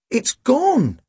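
high-pitched, stunned; open-mouthed astonishment. A narrator's reading. Tim finding the body missing, astonished. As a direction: squeak it out, dumbfounded. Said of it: "Tim's mouth dropped open".

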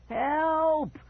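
very high, loud panicked wail; frightened wail. Acted. Boy fleeing wild dogs wails for help. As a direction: scream for help in terror. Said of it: "that frightened wail".

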